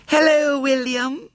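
put-on very high little-girl greeting; small shrill voice. A narrator's reading. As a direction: pipe up in a tiny, piercing voice. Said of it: "said a small, shrill voice".

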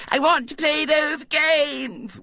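high, slow, shrill wail; wailing little girl. A narrator's reading. Violet Elizabeth wailing to the grown-ups for her games. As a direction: whine it like a spoilt child. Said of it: "wailed Violet Elizabeth".

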